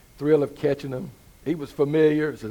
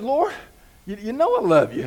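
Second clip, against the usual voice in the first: agitated Peter; 7 semitones higher, 7 dB louder than usual. Retold or imitated. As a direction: Lord jumps up high, then a pleading louder insistence. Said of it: "Peter gets a little bit agitated".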